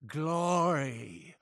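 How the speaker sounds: soft, awed opening of a chant; hushed reverence. Acted. Zealot praising Atom, hushed reverence. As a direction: offer the praise softly before the big cry.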